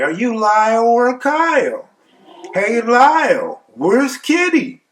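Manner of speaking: high, stretched-out heckling; mocking taunt. Cellmate heckles Lyle as the butt of jokes, mocking taunt. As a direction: holler the names high and slow to tease him. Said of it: "Lyle became the butt of our jokes".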